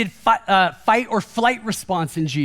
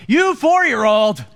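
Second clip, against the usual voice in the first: slow, louder mock scold at a child; mock indignant scold; 4 dB louder, slower than usual. Pastor acting out his inner rant at his child, mock indignant. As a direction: put the weight on four-year-old. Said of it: "and now I'm talking to myself".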